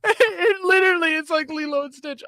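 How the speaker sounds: high, laughing fit; can barely get words out for laughing; laughing hard. Real, not acted. Streamer cracking up at a bad movie line, laughing. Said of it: "laughing at a clip".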